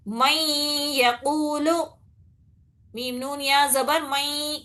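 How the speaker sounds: letters chanted, then the word recited; slow spelling recitation. Shown as a demonstration. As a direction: spell it out like a Quran teacher. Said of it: "spelling and reciting demo".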